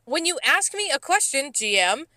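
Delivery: high-pitched indignant rant; fed up, pitch jumping up, slowing hard on the demand; exasperated outburst. Retold or imitated. Server telling off the manager who never listened, exasperated.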